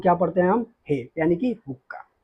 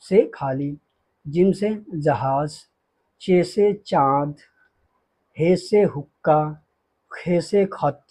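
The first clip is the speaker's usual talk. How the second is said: slow recitation; 2 semitones lower, slower than usual. Shown as a demonstration. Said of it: "teacher reciting letter names slowly".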